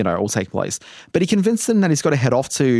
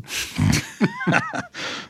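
high laugh; 5 dB quieter than usual. Real, not acted. Laughs at citizen line.

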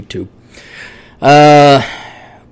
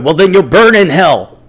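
mock preacher; 4 semitones higher, 5 dB louder than usual. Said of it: "without immediately going".

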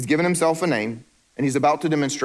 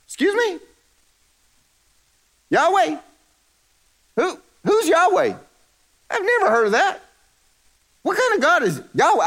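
high, incredulous mock Pharaoh. Pharaoh scoffing at Moses, high mocking voice. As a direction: sneer at the name like a scornful king. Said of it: "And Pharaoh says".

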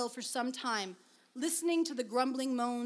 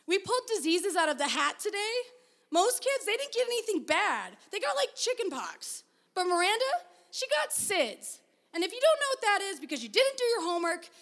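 put-on a high, upset boy rushing through the news; agitated boy Robbie; 7 semitones higher, 3 dB louder than usual. Robbie telling Sierra what happened to their baby, high and agitated. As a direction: pitch it up like a worked-up kid. Robbie explains the class project.

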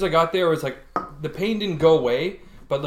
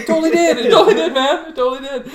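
high, loud, giddy agreement; laughing outburst; 13 semitones higher, 9 dB louder, faster than usual. Retold or imitated. Patient telling the operator it felt like peeing, laughing outburst. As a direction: blurt it over and over, laughing and high. Retelling excited answer about warm sensation.

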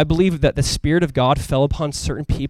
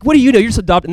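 higher and louder, sneering the put-down; mocking kid taunt; 6 semitones higher, 4 dB louder, faster than usual. Biological kid taunting his adopted brother, loud sneering jab. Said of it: "Like, ow, right? Like".

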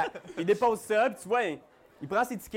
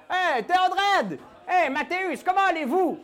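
dock worker voice; 10 semitones higher, 4 dB louder than usual. Acted. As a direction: call out like a jolly dockhand.

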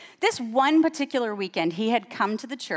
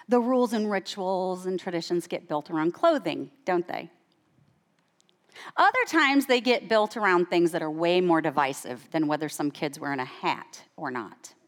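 ordinary talk

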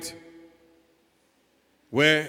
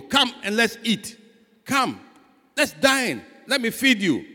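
higher, eager call to come and eat; the Lord inviting guests; 6 semitones higher than usual. Acted. The Lord calling his guests to dinner, higher and inviting. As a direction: call them warmly to the table, pitched up.

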